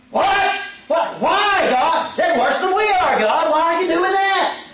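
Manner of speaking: high, loud outraged disbelief; shocked protest. Retold or imitated. Habakkuk shouting his disbelief at God. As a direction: cry out in shock at God's plan.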